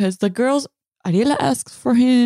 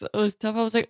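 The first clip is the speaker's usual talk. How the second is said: ordinary delivery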